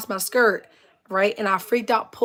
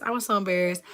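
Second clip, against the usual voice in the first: normal talking voice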